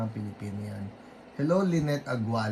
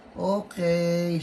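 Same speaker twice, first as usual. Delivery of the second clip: ordinary delivery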